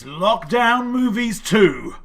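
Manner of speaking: high, loud, drawn-out reveal; dramatic title announcement. Acted. Quiz host revealing the lockdown movies round, in a dramatic announcer voice. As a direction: proclaim it slowly like a big film title.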